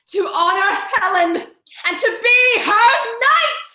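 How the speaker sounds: building to a loud, high vow; rising vow. Acted. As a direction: swear it out, growing grand. Lysander vows loudly.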